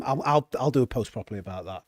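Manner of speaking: ordinary talk, nothing marked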